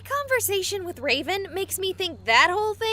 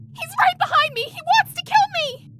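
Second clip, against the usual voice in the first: high, frantic terrified cry; breathless ghostly panic; panicked plea; 11 semitones higher, faster than usual. Acted. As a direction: beg for help while fleeing. Ghost victim fleeing killer.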